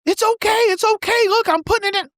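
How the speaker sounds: put-on high-pitched pleading kid; protesting child. Child begging dad over the game, high pleading. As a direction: whine up high like a child bargaining.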